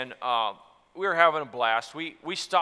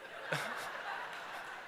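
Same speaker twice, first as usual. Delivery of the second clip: breathy laugh; 13 dB quieter than usual. Real, not acted. Laughing about embarrassment.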